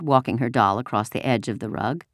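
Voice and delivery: usual speaking voice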